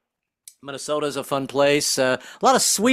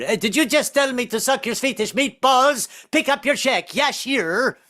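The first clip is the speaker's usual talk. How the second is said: high, indignant telling-off; outraged boss; 9 semitones higher than usual. Acted. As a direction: fire someone in a hammed-up outburst. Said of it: "in front of your boss".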